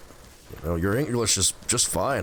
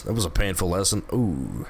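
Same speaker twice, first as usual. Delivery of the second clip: ordinary delivery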